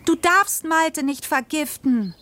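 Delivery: high, drawn-out cry of protest, no real volume; mock yell. A narrator's reading. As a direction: plead in a high, stretched child's cry. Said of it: "schrie ich". (hoher, gedehnter Aufschrei ohne volle Lautstärke)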